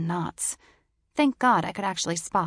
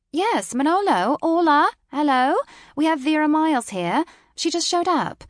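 British woman on the phone; 10 semitones higher, 7 dB louder than usual. A narrator's reading.

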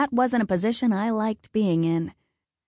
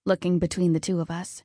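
ordinary delivery, nothing marked

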